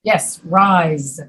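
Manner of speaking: ordinary talk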